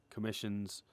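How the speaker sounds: ordinary talk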